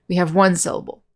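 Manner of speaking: ordinary talk, nothing marked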